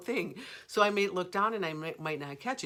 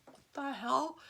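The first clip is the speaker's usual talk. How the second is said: startled; 9 semitones higher than usual. Retold or imitated. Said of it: "This was like".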